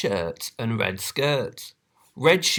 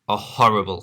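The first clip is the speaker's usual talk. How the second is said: ordinary delivery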